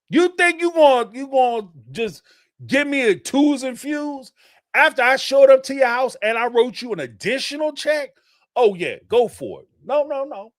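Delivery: acted-out angry challenge, high and loud; indignant confrontation. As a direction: dare them, high-pitched and incredulous. Acts out what Mary should say.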